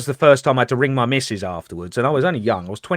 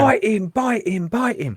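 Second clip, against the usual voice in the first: much higher, slow mock command to a dog; urging the dog; 12 semitones higher than usual. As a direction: egg on the dog to attack. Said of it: "he's going".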